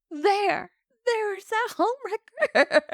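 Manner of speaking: high, melodramatic accusation; hammed-up accusing widow. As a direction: point her out in a high, theatrical cry. Widow pointing out the homewrecker.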